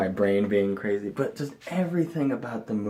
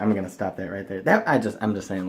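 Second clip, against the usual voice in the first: ordinary talk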